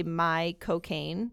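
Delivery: slow Cockney "My cocaine" run together as "Michael Caine"; Cockney drawl on "My cocaine"; Michael Caine accent. Shown as a demonstration. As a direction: say "My cocaine" slowly, like Michael Caine says his name. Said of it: "to say Michael Caine's name perfectly in his accent".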